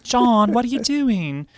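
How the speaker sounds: put-on high, drawn-out accent of no known country; unplaceable put-on accent. Co-host voices singer scolding her stage partner, muddled accent. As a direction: scold slowly in a badly faked foreign accent. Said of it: "I don't know what accent that is".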